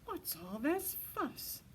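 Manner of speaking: lightly voiced, gently puzzled mother owl. A narrator's reading. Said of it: "their mother owl asked".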